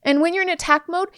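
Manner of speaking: ordinary delivery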